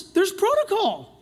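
"protocol" said high and hard, pitched well up; preacher stressing protocol; emphatic protocol. Shown as a demonstration. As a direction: hammer home "protocol" from up high. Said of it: "repeated for emphasis".

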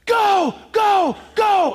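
high, loud barked command; sergeant yelling go. Retold or imitated. Sergeant shouting paratroopers out the plane door, loud barked orders. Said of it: "He says".

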